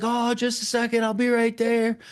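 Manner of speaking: high-pitched put-on character voice; acted stalling reply. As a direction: stall them in a high mock voice.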